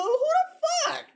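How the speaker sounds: high, slow, drawn-out disbelief; outraged squeal. Real, not acted. Co-host hears the rant about Hulu, outraged high squeal. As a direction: stretch it out like you can't believe it.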